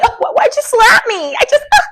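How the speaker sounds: high mocking victim voice; hammed-up wounded whimper; mock victim whine. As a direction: mimic her playing the victim, high and whiny. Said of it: "you wanna say".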